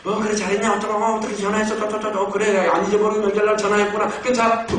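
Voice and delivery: comic impression of a talkative older sister; chattering sister on phone. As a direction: rattle on, leaving no gap for a reply. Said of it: "자기 혼자 말다 하고 또 끊어".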